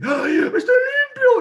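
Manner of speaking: high, slow, ecstatic cry of relief; joyful shout. Acted. Healed leper shouting his joy to everyone, high ecstatic cry. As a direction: shout it out like a healed man who can't believe it. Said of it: "porque él dice".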